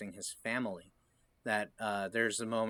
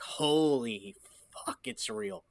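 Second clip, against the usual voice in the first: shocked realization; 5 semitones higher, slower than usual. Retold or imitated. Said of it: "I was like".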